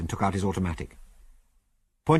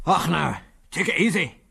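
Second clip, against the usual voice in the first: raised-pitch Scots voice; broad Scots, pitched up; strong Scots accent; 5 semitones higher than usual. A narrator's reading. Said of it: "in a strong Scots accent".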